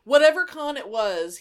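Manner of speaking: ordinary talk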